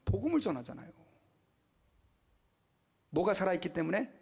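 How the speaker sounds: ordinary delivery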